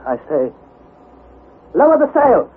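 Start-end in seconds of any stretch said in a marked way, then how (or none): 1.7-2.5 high, loud urgent demand; urgent shout. A narrator's reading. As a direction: call out sharply over the deck. Nephew demands sail be lowered.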